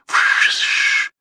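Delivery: a loud unvoiced hissing shh; grimacing whoosh. A narrator's reading. He winces at her answer, loud wordless shh. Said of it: "произнёс что-то вроде".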